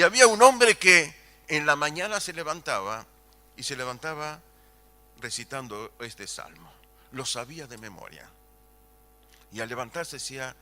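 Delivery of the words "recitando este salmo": ordinary talk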